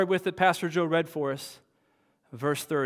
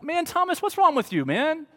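a lightly voiced exasperated Jesus, higher; mock chiding, higher pitched; imagined impatient Jesus; 7 semitones higher than usual. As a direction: scold Thomas like a frustrated friend, pitched up. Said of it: "I would expect Jesus to come in and be like".

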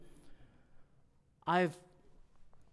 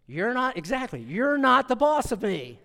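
put-on sass back like a small child; little girl defiance; 6 semitones higher, 6 dB louder than usual. Daughter talking back to siblings, mock defiance. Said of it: "her line was".

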